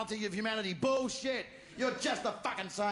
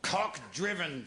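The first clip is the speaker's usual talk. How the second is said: ordinary talk, nothing marked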